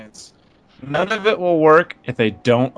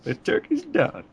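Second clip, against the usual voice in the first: much higher, slow hero quip; Human Torch voice; 6 dB quieter, slower than usual. Retold or imitated. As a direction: squeak it out like a cocky hero. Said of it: "he's like".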